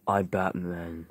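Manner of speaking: very low, soft and slow hero; drawn-out, hushed Batman. Acted. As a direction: say it slow, deep and quiet. Playing Batman in a skit.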